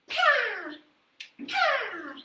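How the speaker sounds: high cawing bird. Acted. Storyteller voicing the bird's call for children. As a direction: caw like a big crow. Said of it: "the bird went".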